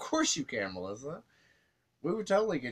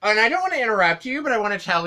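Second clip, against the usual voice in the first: loud; 8 semitones higher, 10 dB louder, faster than usual. Pretend. Loud interruption in impression.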